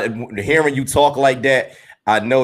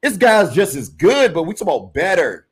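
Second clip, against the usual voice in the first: pitched-up, laughing mock retort; his own comeback; 7 semitones higher than usual. The host fires back at doubters, pitched-up and half laughing. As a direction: act out arguing back, high and animated. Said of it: "I be like".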